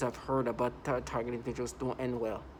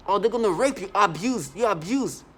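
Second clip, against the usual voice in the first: higher, louder imitated taunt; mock yelling; 5 semitones higher, 7 dB louder than usual. Imitating people yelling accusations at him. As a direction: yell it as a taunt. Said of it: "Something like that".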